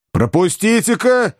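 high, slow angry demand. A narrator's reading. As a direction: demand to be let through. Ivan demands passage.

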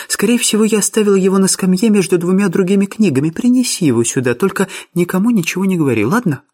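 put-on high young lady's voice, coaxing; secretive Miss Sophia. Miss Sophia sends George on a secret errand, high coaxing voice. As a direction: coax him in a lifted feminine register.

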